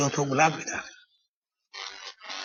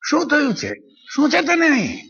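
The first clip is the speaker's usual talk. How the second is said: higher, pressing questions; urgent asking; 8 semitones higher than usual. Acted. Worried questioner shaking the narrator, urgent asking. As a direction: shake the answer out of him. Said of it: "હલબલાવીને પૂછતા".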